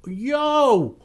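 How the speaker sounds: high-pitched mock "yo" of surprise; acting surprised. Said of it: "caught you by surprise that you're like".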